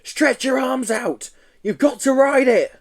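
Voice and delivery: high urgent commands; urgent coaching. Acted. Joe shouting instructions to the Yeti on the skateboard, high urgent. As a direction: coach him frantically.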